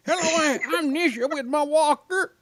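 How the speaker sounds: put-on high, soft old lady voice; sweet old lady. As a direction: play a sweet little grandma. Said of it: "an old lady voice".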